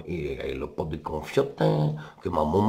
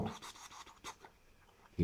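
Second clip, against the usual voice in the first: pas chuchotés; 20 dB quieter, slower than usual. Acted. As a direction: tout tout soufflés, lents, comme des pas. Bruit de pas du loup. (pas furtifs chuchotés, au ralenti)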